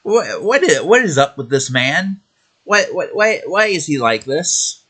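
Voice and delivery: high, incredulous what-is-this outburst; exasperated, stunned questioning; baffled disbelief. Real, not acted. Baffled outburst at Kojima news.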